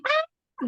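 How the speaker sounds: short, high-pitched little scream; mock scream. Acting out the scream from the zombie video, mock scream. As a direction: let out a quick, high mock shriek. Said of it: "So I screamed".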